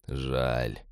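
low, soft, weighty single word; weighty remark. A narrator's reading. Roger responding to Jill's lack of detector, weighty. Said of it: "веско проронил Роджер".

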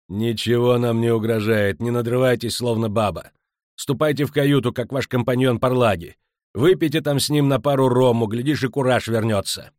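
forceful, irritated dressing-down; scornful scolding. Acted. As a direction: send the coward off impatiently. Captain scolds the panicking merchant.

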